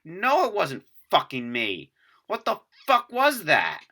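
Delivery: loud, high, drawn-out indignant outburst; angry retort. Retold or imitated. Storyteller re-enacts answering the roommate after the knocks, angry and rattled. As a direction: fire it back, rising to an exasperated shout. Retold frightened, angry text reply.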